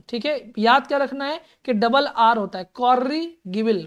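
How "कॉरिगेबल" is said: कॉरिगेबल drawn out slowly with a rolled double r; slow model reading. Shown as a demonstration. Teacher modelling कॉरिगेबल slowly for the class. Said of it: "कॉरिगेबल ऐसे पढ़िएगा".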